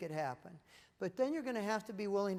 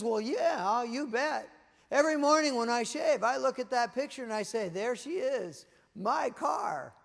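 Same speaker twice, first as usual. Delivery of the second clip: higher, louder, gushing over his dream car; excited husband; 4 semitones higher, 6 dB louder than usual. Acted. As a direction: play a husband mildly hyped-up about his car.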